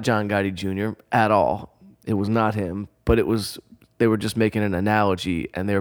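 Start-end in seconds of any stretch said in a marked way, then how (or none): none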